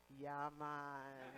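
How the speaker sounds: a laughing Jamaican "Yeah, man"; quick island-accent reply, laughing. Retold or imitated. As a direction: drop into a relaxed Jamaican accent with a chuckle. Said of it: "Cindy asked me if I wanted to keep the pot leaf, and I said".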